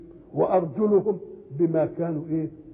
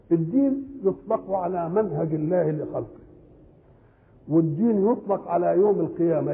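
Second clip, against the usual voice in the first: ordinary talk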